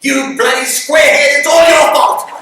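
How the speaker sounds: furious shouted insult, high and loud; angry yell. Retold or imitated. Mister Harding yells at the hiding boy in fury. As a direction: bellow it at the boy hiding nearby. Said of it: "'cause he yelled out".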